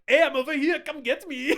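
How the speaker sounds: high, loud goading call, hammed up; mock taunting. Van Damme's character calls to the agents chasing him, mock taunt. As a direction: tease the pursuers like a cartoon hero. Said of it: "turning back around at them and being like".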